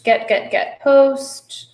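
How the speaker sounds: ordinary delivery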